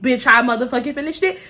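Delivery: ordinary talk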